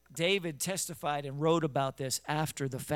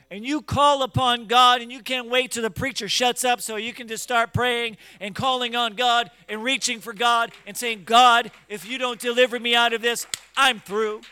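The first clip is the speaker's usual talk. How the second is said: loud, rising preacher's cry; fiery revival preacher, louder and louder; preaching at full force; 4 semitones higher, 8 dB louder than usual. Acted. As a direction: build the call into a shout to God.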